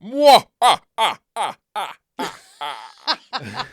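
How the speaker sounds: loud villain laugh, fading out; evil cackle. Pretend. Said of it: "Do your best evil laugh".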